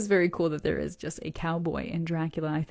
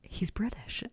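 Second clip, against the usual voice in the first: quiet falling whisper of realisation; mock flat shock; mild flat surprise; 2 semitones higher, 8 dB quieter, slower than usual. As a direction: let it dawn on you, hushed. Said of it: "And then I looked him up and I went".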